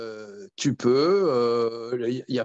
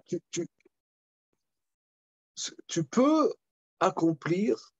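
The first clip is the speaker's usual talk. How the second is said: ordinary talk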